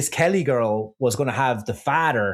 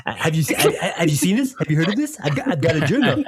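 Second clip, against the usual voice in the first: eager cartoon kid showing off; impersonation; 4 semitones higher than usual. Friend doing an impression to the hosts, eager put-on voice. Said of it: "impersonations I do".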